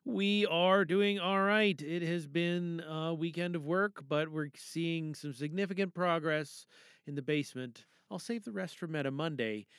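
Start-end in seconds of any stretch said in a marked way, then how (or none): none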